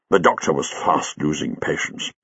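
ordinary delivery, nothing marked

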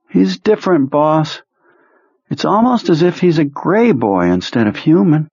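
high, slow, drained voice of a sick man; sick psychic Perlmutter. Acted. Ill telepath reporting to his boss, high and drained. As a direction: sound weak and washed out.

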